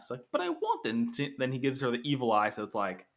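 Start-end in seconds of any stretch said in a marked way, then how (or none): 0.3-0.9 put-on high squeaky kid whine; whiny child voice. Kid whining again.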